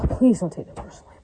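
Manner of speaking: a sighing whisper, breath only; sigh, then breathe the plea without voice. Real, not acted.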